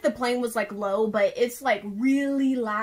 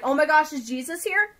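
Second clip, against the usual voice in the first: higher-pitched startled question; retold shock, pitched up; startled disbelief; 4 semitones higher, 6 dB louder than usual. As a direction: ask it in alarmed disbelief. Retells her startled reaction to trumpet.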